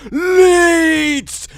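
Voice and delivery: high, loud drawn-out yells; possessed demon screaming. Retold or imitated. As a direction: yell it desperately like a demon possessed. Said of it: "Like some possessed demon thing".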